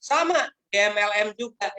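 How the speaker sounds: usual speaking voice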